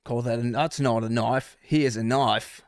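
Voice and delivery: put-on lightly done Crocodile Dundee Australian accent; a hint of an Australian movie hero. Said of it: "I don't have the best Australian accent".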